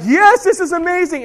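high, loud, thrilled exclamation; excited. Retold or imitated. Preacher imagining his dream trip, thrilled. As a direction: gush like the dream came true.

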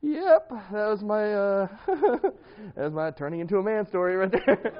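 loud, high, laughing wrap-up; amused. Real, not acted. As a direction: sum it up while cracking up at yourself. Laughing at own disastrous story afterwards.

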